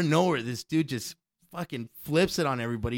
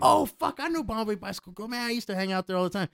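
much higher, excited recollection; excited recognition; 8 semitones higher than usual. Retold or imitated. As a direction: squeal it with recognition. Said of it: "Tim recognizes the club".

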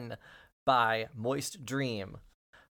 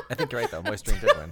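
laughing; 4 dB louder, much faster than usual. Real, not acted. Laughing at the spoiler.

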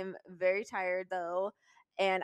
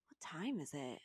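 whisper; 4 semitones lower, 13 dB quieter than usual. Retold or imitated. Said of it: "and she goes".

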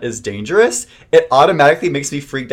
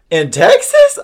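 high, louder astonished question; surprised grandma; 7 dB louder, slower than usual. Retold or imitated. Grandmother hearing Texas is cold, high surprised question. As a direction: squeak it out like a shocked grandma. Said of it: "And she was like".

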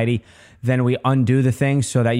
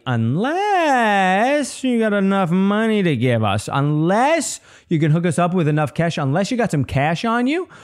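lightly hammed-up official, pitch rising high; mock government official; 7 semitones higher than usual. Government to a defendant, lightly hammed-up. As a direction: draw out the condition like a shakedown. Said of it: "the government is like".

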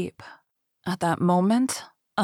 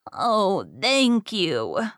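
lightly voiced granny thanks; a hint of old lady; much slower than usual. A narrator's reading. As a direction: thank them as a relieved granny. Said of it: "said the old lady".

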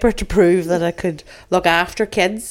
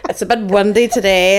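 normal talking voice

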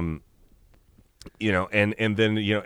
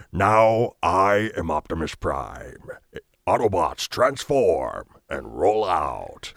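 slow, commanding robot-leader voice; relaxed vocal cords, airy heroic proclamation; Optimus Prime; slower than usual. Shown as a demonstration. Robot leader orders his troops, slow and heroic. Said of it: "from Optimus Prime".

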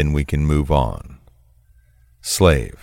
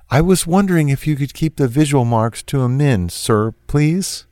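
higher, quicker, meek request; a timid slave, higher and fast; pleading submissive; 9 semitones higher than usual. Acted. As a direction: ask nervously and politely for a favour.

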